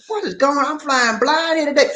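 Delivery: high-pitched comic bewilderment; hammed-up dismay; retold mock alarm. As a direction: voice up high, playing at helpless panic. Retold alarm at missing viewers.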